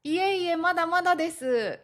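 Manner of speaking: high, humble brush-off of praise; modest demurral. Shown as a demonstration. Replying to praise, humble and reserved. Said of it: "こう遠慮がちに言うこと".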